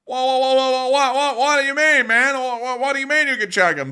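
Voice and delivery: hammed-up indignant shock; mock outrage.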